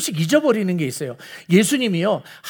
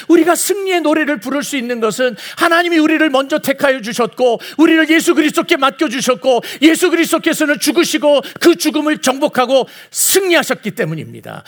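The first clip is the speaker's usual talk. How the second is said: high-pitched, driving sermon climax; impassioned preaching; 6 semitones higher, 3 dB louder than usual. Real, not acted. Preacher explaining why the church sings victory, impassioned. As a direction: drive each clause home with raised pitch.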